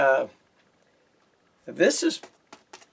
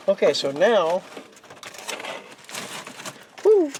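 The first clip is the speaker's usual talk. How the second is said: usual speaking voice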